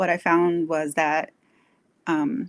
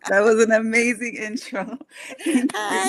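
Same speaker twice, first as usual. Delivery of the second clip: laughing; 6 semitones higher, 5 dB louder than usual. Real, not acted. Guest laughing at intro.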